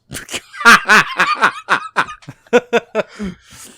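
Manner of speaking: high, loud cracking-up laugh; game master breaking into loud laughter; loud burst of laughter. Real, not acted. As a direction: lose it laughing at the joke.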